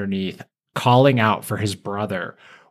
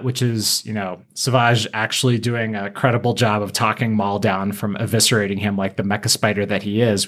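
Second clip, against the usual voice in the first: ordinary talk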